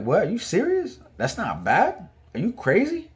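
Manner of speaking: high-pitched, incredulous questions; frustrated disbelief. Retold or imitated. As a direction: shoot up high in exasperated disbelief. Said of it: "now I'm getting, like, frustrated".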